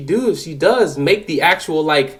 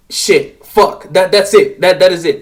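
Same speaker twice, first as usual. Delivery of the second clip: louder burst of panicked swearing; sudden alarmed cursing, louder; alarmed realization; 4 semitones higher, 6 dB louder than usual. Retold or imitated. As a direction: blurt it out as it clicks. Sure she is in danger.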